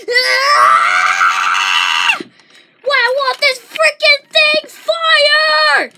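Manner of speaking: loud, slow hammed-up shout of frustration; mock frustrated yell. Kid acting out a player whose gun won't fire, shouting. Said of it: "you're like".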